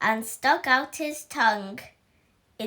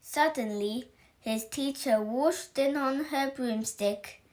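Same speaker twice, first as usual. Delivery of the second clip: plain reading voice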